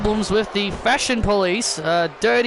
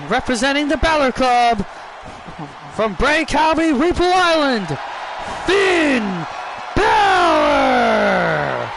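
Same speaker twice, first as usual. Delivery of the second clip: ring announcer; 3 semitones higher than usual. Acted. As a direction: build it up and drop the name. Ring announcer introduces opponent.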